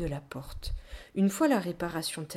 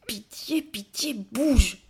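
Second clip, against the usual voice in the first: begging in short bursts, louder on the last; pleading; 2 semitones higher, 5 dB louder, slower than usual. A narrator's reading. Child begs a stuck armchair to move, pleading. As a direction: beseech it, building to a loud final push. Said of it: "suppliai-je".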